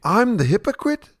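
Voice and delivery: incredulous. Acted. Opponent answering the a-hole's accusation of hypocrisy, incredulous. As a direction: bounce the charge back, incredulous. Incredulous retort in acted quarrel.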